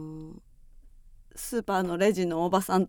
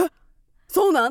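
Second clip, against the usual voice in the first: surprised; 7 semitones higher, 6 dB louder than usual. Retold or imitated. Retells own surprised reaction.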